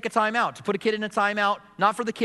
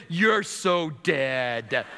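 drawn-out sibling taunt, lower; slow taunting brother; 4 semitones lower, much slower than usual. Retold or imitated. Big brother gloating at the runaway kid, slow and low.

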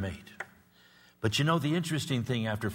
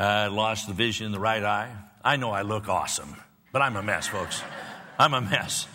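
usual speaking voice

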